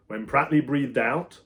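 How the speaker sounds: plain reading voice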